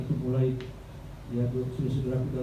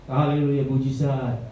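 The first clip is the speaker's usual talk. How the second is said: usual speaking voice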